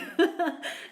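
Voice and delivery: short high, loud laugh. Real, not acted. Greeting people joining in, laughing. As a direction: burst of real laughter.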